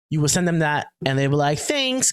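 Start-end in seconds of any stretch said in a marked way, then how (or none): 1.6-2.1 high, quick mock-grateful voice; high-pitched cheeky thanks; chirpy scammer. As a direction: chirp it like a pleased swindler. Voices the gleeful scammer taking money.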